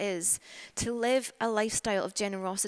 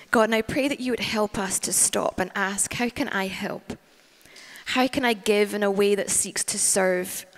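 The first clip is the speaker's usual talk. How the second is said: louder, earnest praying voice; fervent prayer; 5 dB louder than usual. Real, not acted. Preacher praying to God for the church, fervently. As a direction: lift the prayer up over the room.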